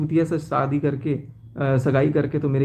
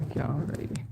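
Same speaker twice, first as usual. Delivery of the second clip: dejected murmur; 2 semitones lower, 10 dB quieter than usual. Real, not acted. Breaks down on live.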